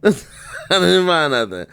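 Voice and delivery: laughing through the words. Real, not acted. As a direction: chuckle it out, amused. Repeats it while laughing.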